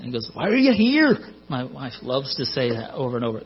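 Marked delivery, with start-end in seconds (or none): 0.3-1.2 high, loud mock-Scottish outburst; Scottish accent. Imitating the father in the fort, raised mock-Scottish accent. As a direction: imitate a Scottish film dad demanding an answer. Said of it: "one of them had a Scottish accent".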